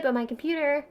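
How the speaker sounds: normal talking voice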